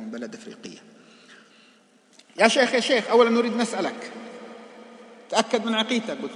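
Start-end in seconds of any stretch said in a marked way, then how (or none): 2.4-6.2 loud, urgent calls of يا شيخ; shouting young men. Retold or imitated. Young men shouting at the sheikh in the mosque, loud and demanding. Eager youths confront the sheikh.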